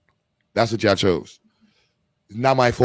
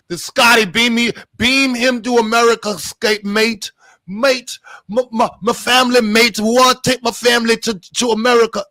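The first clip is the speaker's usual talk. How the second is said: loud, high, hammed-up Scottish attempt; bad Scottish accent; 9 semitones higher, 6 dB louder than usual. Begging a mate to take his family, badly faked Scottish accent. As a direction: stammer out a mock-desperate plea in botched Scottish. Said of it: "I don't know how to do your accent".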